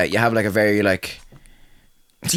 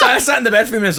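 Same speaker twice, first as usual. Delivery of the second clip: laughing; 9 semitones higher than usual. Real, not acted. Laughing while retelling.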